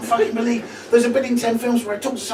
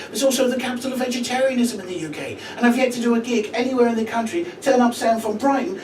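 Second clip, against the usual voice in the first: ordinary delivery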